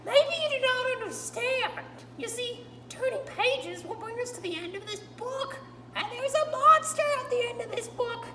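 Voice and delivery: Grover voice. Acted. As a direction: play Grover, nervous and pleading with the reader. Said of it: "told by my friend Grover".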